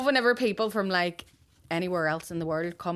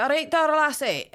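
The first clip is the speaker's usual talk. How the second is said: higher, Shrek-like Scottish voice; mock Scottish accent; 7 semitones higher than usual. Scottish lorry driver calling down from his window, mock Scottish accent. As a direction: put on a cartoonish Scottish accent. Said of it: "I don't know why he was Shrek".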